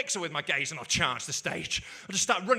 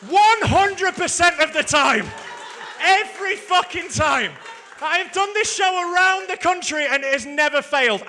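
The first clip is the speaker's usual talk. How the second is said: triumphant shout; 9 semitones higher, 12 dB louder than usual. Real, not acted. Comedian boasting his trick never fails, triumphant shout. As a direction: crow it to the whole room. Crowing after the trick works.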